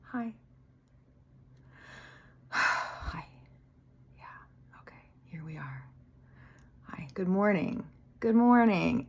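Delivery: breathy hushed greeting, sighing between; sleepy whispered greeting to God; soft morning whisper to God. Retold or imitated. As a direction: whisper hello to God before opening eyes. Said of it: "I'll just go".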